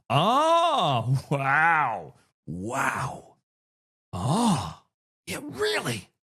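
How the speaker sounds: hammed-up amazement with stretched-out vowels; badly faked wide-eyed interest; fake excitement. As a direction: overdo polite fascination, swooping and drawn out. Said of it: "try to use the excited voice or the interested voice".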